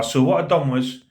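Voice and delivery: ordinary delivery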